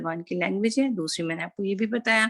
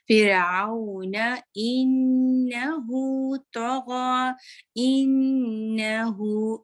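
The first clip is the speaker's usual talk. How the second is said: high, slow chanted recitation; slow melodic recitation, nun held long; Quran recitation; 5 semitones higher, 3 dB louder, much slower than usual. Shown as a demonstration. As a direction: sing it out, full nasal ghunna on the nun. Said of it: "नून का गुन्ना अच्छा करें".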